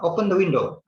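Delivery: bare, curt command; a touch rude, commanding. Shown as a demonstration. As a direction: order it flatly, no please. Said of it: "it's a little bit, um, quote unquote rude".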